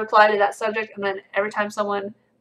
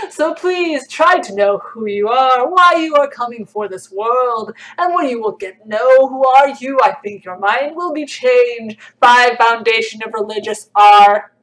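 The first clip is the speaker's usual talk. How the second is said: louder, slow, solemn mystic voice; 3 semitones higher, 8 dB louder than usual. Pretend. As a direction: intone it like a mystic sage. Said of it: "like, mystic voice".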